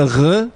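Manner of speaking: "r" rasped in the back of the throat; guttural r. Shown as a demonstration. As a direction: make the "r" a throaty French-style sound. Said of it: "the R in the back of your throat".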